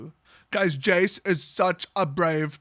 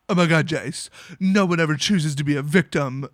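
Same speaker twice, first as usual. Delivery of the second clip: loud, lower-pitched dramatic protest; mock melodrama; 4 semitones lower, 5 dB louder than usual. Vraska interjects to Jace, mock melodramatic. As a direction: interject with hammed-up earnestness.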